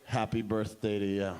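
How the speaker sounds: deadpan second line. Retold or imitated. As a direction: recite it deep and deadpan. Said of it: "Second line".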